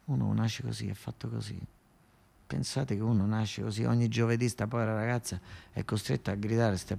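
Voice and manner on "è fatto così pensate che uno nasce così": ordinary talk, nothing marked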